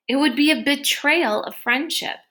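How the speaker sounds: ordinary delivery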